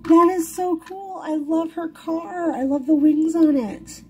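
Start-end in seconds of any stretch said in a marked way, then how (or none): none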